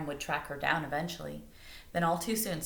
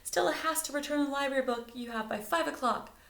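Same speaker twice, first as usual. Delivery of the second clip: put-on higher, urgent boy voice; Duff's voice; 9 semitones higher than usual. A narrator's reading. Duff pleading with Miss Flynn, higher urgent voice. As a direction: press her to hurry.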